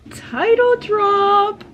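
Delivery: high, loud, drawn-out cheer; excited shout. Real, not acted. Reader celebrating the book's title drop, loud high cheer. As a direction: cheer it like a win.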